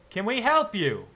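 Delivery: high, loud challenge; guard calling out. Acted. Guard calls out to the stopped riders, loud and wary. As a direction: hail the riders from the carriage. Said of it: "calls out".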